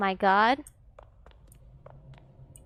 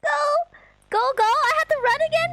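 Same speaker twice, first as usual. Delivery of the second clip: panicking gamer; 10 semitones higher, faster than usual. Real, not acted. Chased again.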